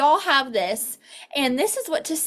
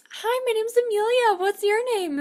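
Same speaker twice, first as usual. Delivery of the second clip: higher, sunny greeting to a manager; friendly intro; 10 semitones higher than usual. Shown as a demonstration. Consultant greeting a shop manager, sunny. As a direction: pitch up and sound welcoming. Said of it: "then you would say".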